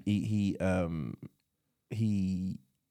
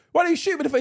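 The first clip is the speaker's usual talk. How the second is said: high, loud, laughing heckle; mock shouting; 4 dB louder, much faster than usual. Friend acting out someone in the film, high mock shout. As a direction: call it out like an excited onlooker.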